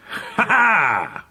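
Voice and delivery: high, gloating "ha, ha"; cruel laugh. A narrator's reading. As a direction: laugh baring his teeth. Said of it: "he cried with a laugh".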